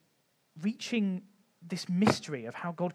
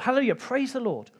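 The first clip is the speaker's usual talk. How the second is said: joyful praise; 7 semitones higher, 4 dB louder than usual. Pretend. Joyful call of praise in lecture.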